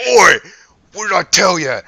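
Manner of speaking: loud, high, angry yell; Bear yelling. Acted. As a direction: shout him down, fed up. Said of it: "you hear Bear go".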